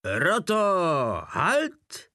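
high, loud parade-ground bark; roaring command. A narrator's reading. Drobeček bellows at the company, a sudden loud roar. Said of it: "a k překvapení všech zařval".

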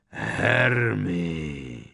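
long, rumbling giant's call; deep thundering rasp. A narrator's reading. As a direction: rasp the name out like a huge giant. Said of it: "hlubokým burácivým hlasem zachroptěl".

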